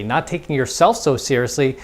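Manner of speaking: ordinary delivery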